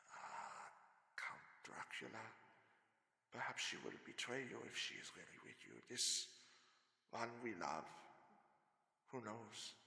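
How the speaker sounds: whispered, low challenge to the Count; soft, low voice to a hidden enemy; hushed hypnotic taunt. Acted. Van Helsing hypnotic, whispered taunt.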